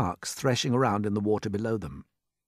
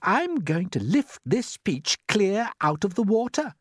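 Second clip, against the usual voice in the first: higher, slow and deliberate declaration; firm announcement; 10 semitones higher, 4 dB louder, slower than usual. A narrator's reading. James telling the others his peach plan, firmly. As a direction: announce it firmly, taking your time. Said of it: "James announced firmly".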